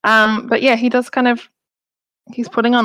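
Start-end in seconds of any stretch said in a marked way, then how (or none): none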